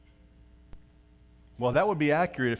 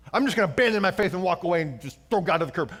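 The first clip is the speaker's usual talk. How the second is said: high, loud defiant walk-away voice; mock rebellious; 7 semitones higher, 8 dB louder than usual. Pastor mocking someone abandoning faith, loud. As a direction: toss faith aside dramatically. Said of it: "attitude that says".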